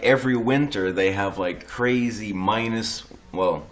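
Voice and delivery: usual speaking voice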